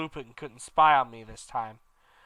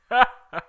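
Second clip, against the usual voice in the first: higher, louder short villain cackle; evil laugh; 9 semitones higher, 7 dB louder than usual. Acted. Dumbledore laughing evilly at the students. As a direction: cackle at them cruelly. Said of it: "He laughed in an evil voice".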